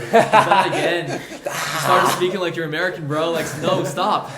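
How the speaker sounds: loud mock telling-off of himself; mock dismay at catching your own slip; exasperated self-scolding. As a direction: scold yourself, hammed-up and exasperated. Acting out scolding himself on playback.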